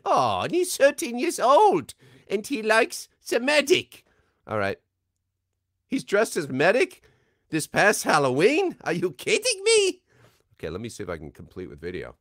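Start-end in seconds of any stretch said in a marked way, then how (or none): 0.0-10.0 put-on high, loud German-accented doctor; Medic character voice. Reading a fan's request as the Medic. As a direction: play the excited German Medic.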